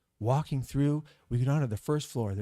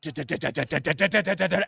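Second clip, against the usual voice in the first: rapid staccato "da-da-da"; imitated climbing clatter; 6 dB louder, much faster than usual. Angry man climbing the fire escape, rattled-off imitation. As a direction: sound out fast footsteps coming up, getting louder.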